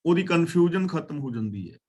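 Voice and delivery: ordinary talk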